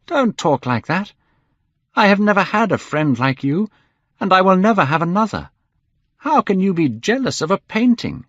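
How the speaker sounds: high, urgent pleading; impassioned cry. A narrator's reading. Painter to his despairing friend, urgent pleading. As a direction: plead earnestly, pitched well up. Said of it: "he cried".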